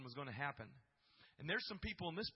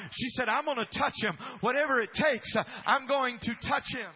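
loud, high, driving sermon voice; fired-up preacher building to the climax; preaching at full force; 4 semitones higher, 13 dB louder than usual. Acted. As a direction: preach it out to the whole church.

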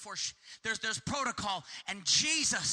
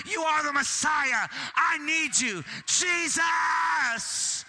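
loud, higher desperate shout to Jesus; crying out; 5 semitones higher, 4 dB louder than usual. Retold or imitated. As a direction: call out at full voice, begging from the crowd. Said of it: "stand up somehow and say".

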